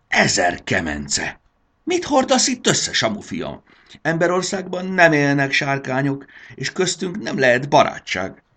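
a furious dragon mother berating her son; angry scolding. Acted. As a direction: tell him off in outrage.

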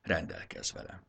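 low, weary, almost breathless; a worn-out beast bowing its head; deep tired voice. A narrator's reading. The defeated bird offers itself, weary and low. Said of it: "mondta mély, fáradt hangon".

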